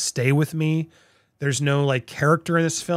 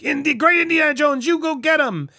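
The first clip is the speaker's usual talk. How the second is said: Salah cheering; 10 semitones higher than usual. Retold or imitated. Salah cheering Indiana on to go get him, high and excited. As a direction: cheer Indy on like an eager old friend. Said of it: "he's like".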